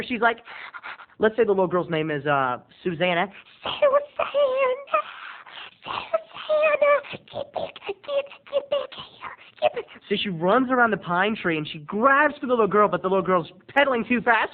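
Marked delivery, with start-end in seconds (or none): 3.6-9.8 very high, held-back panicked call; strangled yell. Retold or imitated. Mother calling her runaway daughter back, stifled panic. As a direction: scream without letting it out. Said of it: "she can't scream 'cause she doesn't want Roberta to hear".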